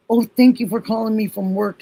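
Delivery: high, bright grateful thanks; sweet thankful younger self. Retold or imitated. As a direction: sing out the thanks to him. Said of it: "And I used to say to him all the time".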